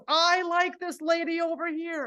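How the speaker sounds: high, delighted announcement; delighted instructor. Retold or imitated. Aerobics instructor praising a keen swimmer, delighted. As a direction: pitch it up, singling someone out approvingly.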